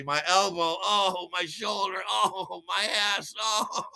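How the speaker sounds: high, drawn-out moans of pain; hammed-up aching groans; acted groaning in pain. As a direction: wail it like a battered faller. Acts out injuries after fall.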